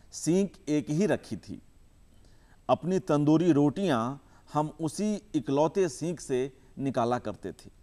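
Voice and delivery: measured recital with long pauses between phrases; poem recitation. Acted. Poet reciting his poem to the audience, measured and unhurried. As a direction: savour each image, let the lines breathe.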